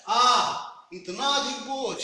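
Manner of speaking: slow, loud strained "आह"; aching, stretched "आह"; pained groan. Shown as a demonstration. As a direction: groan under a heavy load. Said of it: "ये मेरा tone जो है".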